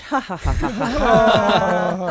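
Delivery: slow forced ha ha chuckle on the out breath; little chuckle. Shown as a demonstration. Laughter yoga teacher leads the studio in a chuckle. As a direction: laugh it out slowly, ha by ha. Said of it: "do a little chuckle on the out breath".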